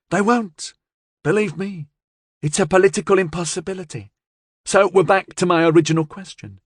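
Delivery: high-pitched woman briskly reassuring her boss; confident gangster's partner. A narrator's reading.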